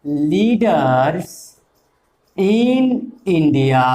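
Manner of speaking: slow, spaced out dictation. Shown as a demonstration. Teacher to class, dictating the sentence end slowly. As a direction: let each word land for the notebook.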